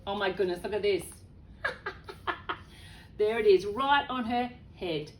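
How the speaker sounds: usual speaking voice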